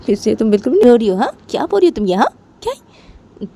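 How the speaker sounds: acted exasperated disbelief; disbelieving questioning. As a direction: challenge the denial, pitch jumping up. Sonam disbelieves Poonam's denial.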